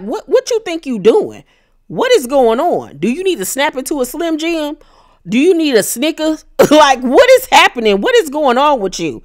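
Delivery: high-pitched mock confrontation; indignant, incredulous acted challenge; confrontational. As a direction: challenge them in hammed-up outrage. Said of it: "Brandy then storms back over with her finger pointed".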